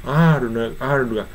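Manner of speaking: ordinary delivery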